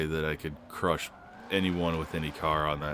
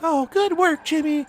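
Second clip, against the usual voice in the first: very high, louder patronizing praise; mock teacher; 8 dB louder than usual. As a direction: pat the kid on the head with your voice. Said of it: "where it's like".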